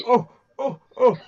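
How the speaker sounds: mock alarm. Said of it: "she goes".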